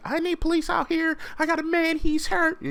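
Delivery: very high, urgent mock lady voice; high falsetto caller reporting an accident; lady on the phone. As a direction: squeak it into the phone in a panic. Lady calling police.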